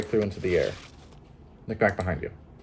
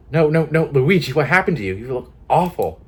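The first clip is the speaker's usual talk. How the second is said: shocked dismay; 5 semitones higher, 7 dB louder than usual. Acted. Friend sees aged, broken Luigi.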